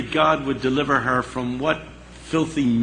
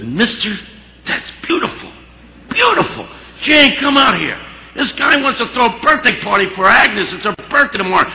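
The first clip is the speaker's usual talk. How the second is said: much higher and louder, calling out with delight; overjoyed diner owner; 9 semitones higher, 6 dB louder than usual. Retold or imitated. Harry thrilled, calling out about Agnes's party. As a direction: burst out with joy, then yell to the back.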